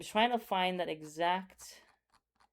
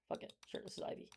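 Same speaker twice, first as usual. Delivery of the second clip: whispered aside; 19 dB quieter than usual. Real, not acted. Muttered aside while drawing.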